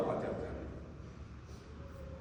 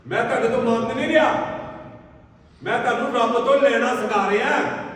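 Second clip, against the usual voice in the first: louder, higher pulpit preaching at full force; fervent preaching; 6 semitones higher, 7 dB louder than usual. Acted. Preacher telling the congregation how to give, fervent loud preaching. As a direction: drive it home to the congregation.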